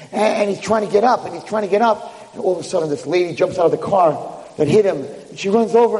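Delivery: ordinary delivery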